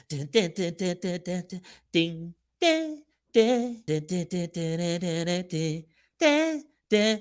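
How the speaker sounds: imitating villain music. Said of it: "evil villain music".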